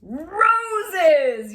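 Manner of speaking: high, loud alarmed shout; yelled. Acted. Doctor shouting in alarm at the roses. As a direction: yell it like a warning. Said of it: "yelled the doctor".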